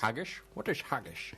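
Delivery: lightly voiced Scottish movie star quoting Highlander; Sean Connery impression. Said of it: "Sean Connery".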